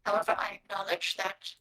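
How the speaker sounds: low, very soft gravelly creature, half whispered. Acted. Game master voices a wasp only you understand, gravelly voice. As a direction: rasp it out quietly and low, with formal courtesy. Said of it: "You hear the gravelly voice go".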